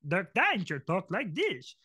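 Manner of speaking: slow, put-on cartoon character voice; Dirk Danger. Shown as a demonstration. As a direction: stretch it out as a swooping cartoon character. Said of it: "Or Dirk Danger off Almost Naked Animals".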